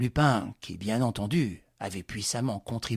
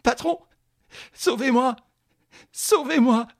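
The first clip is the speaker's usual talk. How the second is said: very high, loud panicked cry; child crying for help; 12 semitones higher, 8 dB louder than usual. Acted. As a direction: call for rescue like a frightened child. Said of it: "comme un enfant qui appelle au secours".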